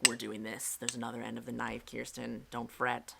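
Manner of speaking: low, soft inner voice taking charge; subconscious mind voice. Acted. Subconscious mind telling her not to fret, low and quiet. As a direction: drop low and quiet, as your own subconscious. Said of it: "My subconscious mind took over and was like".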